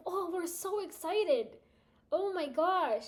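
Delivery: high, slow, excited brand voice; gushing company rep. Pretend. Pretend Skyr company rep gushing to the streamer, high and excited. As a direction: overflow with brand enthusiasm.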